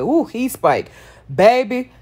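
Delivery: normal talking voice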